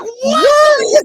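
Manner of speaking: very high, loud shrieked what; hammed-up falsetto shriek; shocked scream. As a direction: scream it in total disbelief. Shocked viewer reaction.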